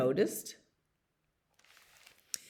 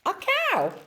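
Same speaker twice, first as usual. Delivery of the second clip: high-pitched exclamation; high cry of delight; excited discovery. Acted. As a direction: squeal it on sighting the prize. Dog spots supposed cow.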